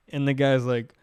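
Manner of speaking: normal talking voice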